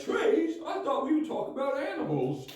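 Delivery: high squeaky voice, bewildered by the trees; confused sidekick. Retold or imitated. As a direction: pitch way up as a baffled cartoon sidekick. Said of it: "And the other guy's like".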